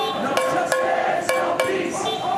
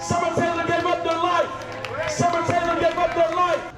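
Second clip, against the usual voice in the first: high shouted protest speech; rousing union rally; 12 semitones higher, 4 dB louder than usual. Real, not acted. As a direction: shout it out to the whole street. Shouting to diners from march.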